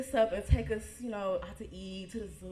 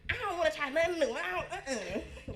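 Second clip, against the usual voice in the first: higher, fast, sulky little sister; attitude; 8 semitones higher than usual. Retold or imitated. Little sister refusing to try new food, with attitude. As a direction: snap it back like a stubborn kid. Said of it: "she got a little attitude".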